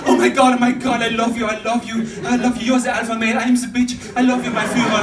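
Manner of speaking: high, loud, frantic dog adoration; hammed-up ecstatic devotion; adoring dog. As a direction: gush like an overexcited dog worshipping its master. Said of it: "That dog's like".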